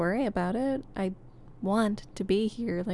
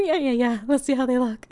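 usual speaking voice

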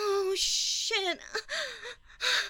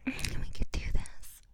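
whisper. Real, not acted. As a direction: whisper it, drained and satisfied. Spent afterglow remarks.

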